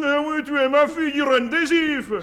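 loud, high, insistent announcement; emphatic proclamation. Acted. General telling the girl she is his daughter, emphatic. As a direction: proclaim it with military force.

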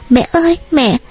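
put-on high child voice calling mother; frightened child crying. A narrator's reading. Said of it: "sợ hãi khóc ầm lên".

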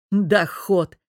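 mocking laugh on the word; scoffing laugh. A narrator's reading. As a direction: laugh it off scornfully. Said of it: "хохотнул Богдан".

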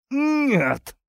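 high, drawn moan of denial; pained moan. A narrator's reading. Worker denies arson under torture, moaning. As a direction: groan the word out in agony. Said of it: "простонал Тихон".

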